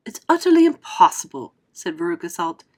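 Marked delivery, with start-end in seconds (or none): 0.1-1.5 put-on high, loud scornful girl; scoffing girl. Veruca to Wonka, high loud scoff. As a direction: scoff it like a spoiled brat.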